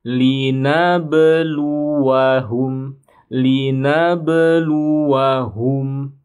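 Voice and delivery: slow melodic linabluwahum with a clear qalqalah; bounced qalqalah. Shown as a demonstration. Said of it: "Huruf ba-nya dipantul".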